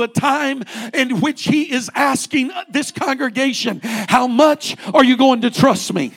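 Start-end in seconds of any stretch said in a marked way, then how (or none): none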